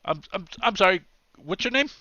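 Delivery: high-pitched, stammering guard voice; apologetic guard. Acted. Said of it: "The guard is already looking".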